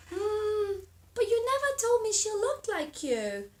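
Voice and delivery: butterfly voice, high and bright. Acted. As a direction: chirp it as the butterfly. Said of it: "butterfly's voice".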